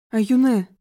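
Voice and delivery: loud name call. Acted. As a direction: call her name urgently. Father calls out the girl.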